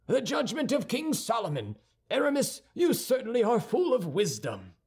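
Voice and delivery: high-pitched cry of acclaim; exclaiming. A narrator's reading. Guardsmen acclaiming Aramis's judgment, exclaiming. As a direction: pitch it well up, like cheering a verdict. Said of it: "cried the other two guardsmen".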